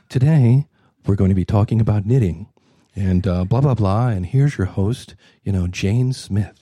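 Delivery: mock a sleepy knitting-show intro; dull intro. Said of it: "or the opposite".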